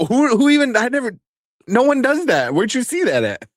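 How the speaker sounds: hammed-up baffled outrage, stumbling over the words; retold disbelief. Dad questioning son about the prank, incredulous.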